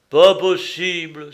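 loud, drawn-out exclamation of disbelief; astonished cry. A narrator's reading. Companion hears some have no eyes, astonished cry. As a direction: cry out slowly in amazement. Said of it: "s'écria Théophraste".

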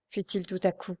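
ordinary delivery, nothing marked

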